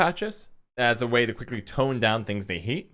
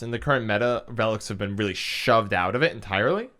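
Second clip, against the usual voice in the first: normal talking voice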